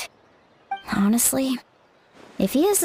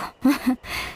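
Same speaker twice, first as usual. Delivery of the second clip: a short shy laugh. Acted. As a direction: chuckle lightly, a little embarrassed. Pleased after a compliment.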